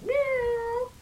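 playful high-pitched meow. Acted. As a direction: meow like a cat. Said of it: "to meow loudly".

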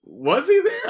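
high, loud questioning of his presence; high-pitched, doubting outburst; incredulous question. Retold or imitated. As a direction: pipe up loudly in disbelief. Questioning whether Damon was really there.

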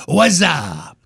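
drawn-out "wazzup" shout-out; catchphrase demo. Shown as a demonstration. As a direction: stretch it into one goofy slurred word. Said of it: "do something like this".

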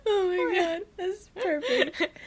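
laughing through tears. Real, not acted. Crying with laughter.

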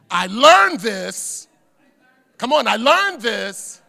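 loud, drawn-out proclamation; emphatic preaching. Real, not acted. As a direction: punch it out loud, stretching the key word. Preacher urging the congregation to respond.

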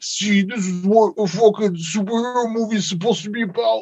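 lightly voiced know-it-all fan; fanboy voice. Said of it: "the D C fanboys are gonna be like".

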